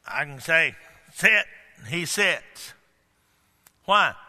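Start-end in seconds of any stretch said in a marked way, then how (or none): 1.1-1.5 firm, loud dog command; authority in voice. Shown as a demonstration. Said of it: "I got authority in my voice".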